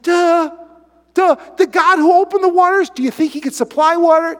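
high, loud mocking disbelief; preacher mocking the whining Israelites; exasperated duh. Real, not acted. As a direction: scoff at how obvious it is. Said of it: "exasperated mockery".

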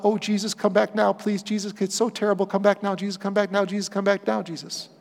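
half-hearted mock begging for rescue; mock pleading prayer. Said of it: "the solution is not".

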